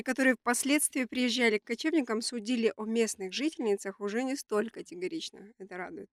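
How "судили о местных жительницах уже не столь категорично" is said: normal talking voice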